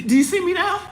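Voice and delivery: high, laughing mock plea; a high, laughing voice begging to be seen; laughing crowd voice. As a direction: squeal it out through a laugh.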